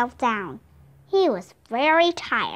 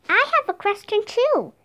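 put-on high girlish voice; 9 semitones higher than usual. Annie, a girl, asks Slim.

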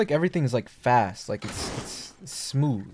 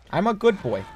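high cutesy voice; 7 semitones higher, faster than usual. Pretend. Alien voice, cutesy.

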